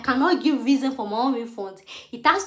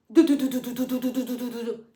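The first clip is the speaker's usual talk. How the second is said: quick repeated do do do for falling tears; mock tear drops. Showing friends how her tears kept falling, a playful drip sound. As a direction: tap out the tears dripping. Imitating tears dropping nonstop.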